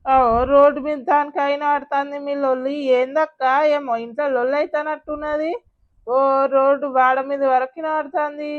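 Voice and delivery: a busybody shouting from the street; nosy neighbour. Acted. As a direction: neighbour woman remarking loudly on the family fight. Neighbour voice from outside.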